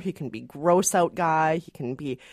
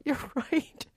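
laughing through a mock sentimental gush; mock tearful gushing with a laugh; 6 semitones higher than usual. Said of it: "suddenly I'm like".

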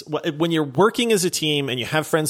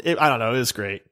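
ordinary talk, nothing marked

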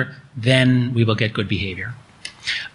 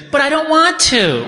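put-on much higher, louder little kid whining; child's whiny refusal; 14 semitones higher, 3 dB louder than usual. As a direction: imitate a small boy refusing. Son Max refuses the ice cream.

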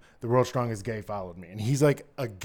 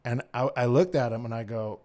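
normal talking voice

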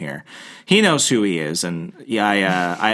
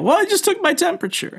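much higher mock voice of a panicked friend; retelling a friend's call, imitating him much higher. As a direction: play the friend up high and fretful. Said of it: "How do you feel?".

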